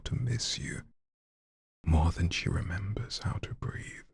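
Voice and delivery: spoken-word poetry whispered to an audience; dramatic poem reading. Acted. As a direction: lean in and whisper the verse. Reads a poem aloud.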